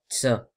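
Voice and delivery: "ta" with air leaking, like "tsa"; Moroccan-style "tsa"; airy T. Shown as a demonstration. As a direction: let a hiss follow the t of "ta". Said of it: "c'est pas".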